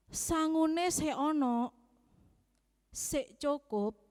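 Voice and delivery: lightly voiced father, slow Javanese question; father asking. Dad asks his student child about money, lightly imitated. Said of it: "bapak saya tanya lagi".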